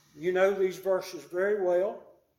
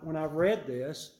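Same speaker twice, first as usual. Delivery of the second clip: ordinary talk, nothing marked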